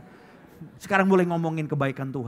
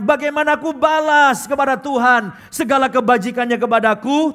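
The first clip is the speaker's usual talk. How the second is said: congregational reading; 9 semitones higher, 11 dB louder than usual. Real, not acted. Reading verse together again.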